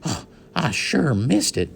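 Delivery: high, delighted cowboy question; light up at the news of the hat; cowboy thrilled his lost hat is found; excited. A narrator's reading. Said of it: "jumped to his feet excitedly".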